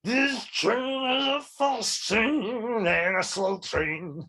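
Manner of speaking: slow, strained soul-singer wail. Shown as a demonstration. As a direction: belt it out like a gravel-voiced rock singer. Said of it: "a voice that was supposed to sound like [name]".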